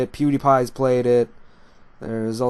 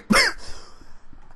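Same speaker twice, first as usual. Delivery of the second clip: harsh coughing fit; 4 dB quieter than usual. Real, not acted. Still coughing from wasabi.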